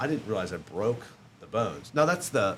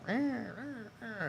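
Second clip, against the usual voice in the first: high, nasal-free mimicked dirt bike revs; softer, high-pitched "rah" engine noises; imitated engine revving; 14 semitones higher, 7 dB quieter, much slower than usual. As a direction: rev like a dirt bike with your voice.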